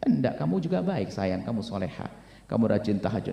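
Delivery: soft, low, soothing husband; tender reassurance. Acted. Husband reassuring his wife she is good, tender and soft. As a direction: gently hush her doubts.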